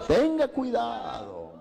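high, slow shouted warning; preacher's high, drawn-out cry of caution. Real, not acted. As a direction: call out the warning slowly to the whole church. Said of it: "Tenga cuidado quien lo aconseja".

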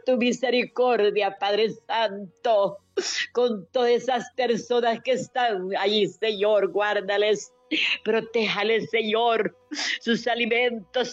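rising, pleading prayer with tearful catches; fervent prayer. Acted. Woman praying for the suffering and the jailed, tearful and pleading. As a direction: pour it out to God, voice near breaking. Leads a long intercessory prayer.

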